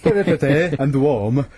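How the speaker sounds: hammed-up imitation of a bad British accent. Host mimicking the sitcom boyfriend, bad British accent. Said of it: "doing what he thinks is a British accent".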